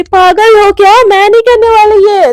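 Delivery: loud, high are-you-crazy outburst; indignant loud refusal; outraged protest. Acted. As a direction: scoff loudly at the order. Mishka refusing exercise.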